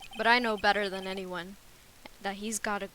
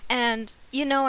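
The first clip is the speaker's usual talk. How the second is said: usual speaking voice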